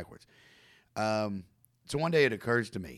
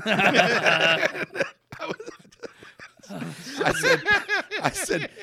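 laughing; 14 semitones higher, 8 dB louder, much slower than usual. Real, not acted. Laughing while retelling his excuse.

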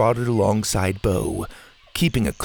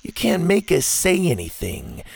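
higher, smug taunt; 7 semitones higher than usual. Acted. Ash taunts Zeta, refusing to tell her, smug. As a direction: lord it over them. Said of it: "Ash replied smugly".

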